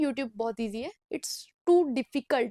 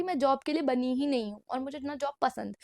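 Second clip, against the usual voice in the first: ordinary delivery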